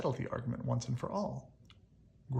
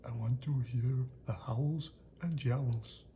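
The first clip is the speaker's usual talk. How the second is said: eager little creature. Acted. Grump eager outside bakery.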